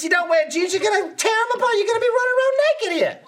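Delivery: high, exasperated werewolf-leader rant; imitated yelling. Werewolf boss berating his pack at full moon, imitated yelling. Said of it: "yelling at everyone".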